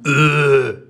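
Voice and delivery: loud cringing "ugh" of disgust; a full-body groan of revulsion. Acted. Host groans at cheesy couple posts, disgusted. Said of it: "fui eu agregar-me todo".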